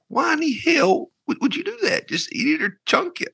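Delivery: high-pitched incredulous rant; mock-baffled protest; exasperated disbelief. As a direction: voice jumps up high, baffled at such a choice. Acting out his baffled reaction.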